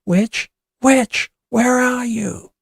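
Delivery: high, loud, drawn-out search call. A narrator's reading. Boys search the cornfield for Witch, calling. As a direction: call into the dark for someone. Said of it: "The boys call".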